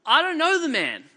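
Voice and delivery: loud, high-pitched protest; insistent sworn denial read aloud; vehement denial. A narrator's reading. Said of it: "He denied it again with an oath".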